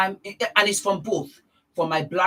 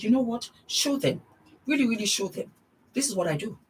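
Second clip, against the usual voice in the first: retold reply; 5 semitones higher, faster than usual. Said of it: "I just said".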